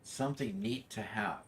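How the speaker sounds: ordinary talk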